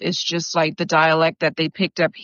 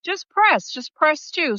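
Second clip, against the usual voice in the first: higher, mock-impatient retelling of the correction; 10 semitones higher than usual. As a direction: spell it out for the confused stranger, pitched up. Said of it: "she said, you know".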